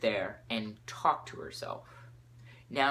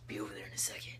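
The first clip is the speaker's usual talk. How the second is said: very soft, whispery put-on teacher voice; husky hero murmur, barely voiced; Batman; 3 semitones lower, 10 dB quieter, much faster than usual. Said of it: "that's just the voice I'm gonna give him".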